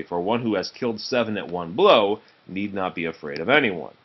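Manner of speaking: ordinary delivery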